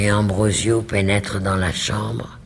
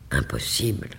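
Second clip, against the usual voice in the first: plain reading voice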